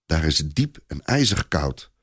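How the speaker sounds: usual speaking voice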